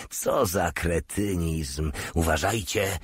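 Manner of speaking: plain reading voice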